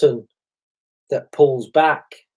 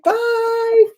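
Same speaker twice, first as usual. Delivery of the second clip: calling bye. Retold or imitated. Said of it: "So we're saying".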